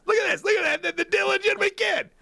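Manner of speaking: hammed-up teasing shout; mock taunting buddy. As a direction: rub it in gleefully. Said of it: "Every time he came over".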